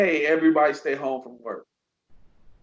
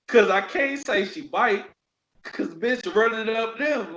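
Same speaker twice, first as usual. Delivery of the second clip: ordinary talk, nothing marked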